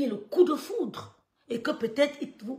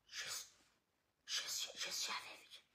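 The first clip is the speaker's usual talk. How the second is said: hushed whisper; 19 dB quieter than usual. Retold or imitated. Cousin's hushed reply on phone.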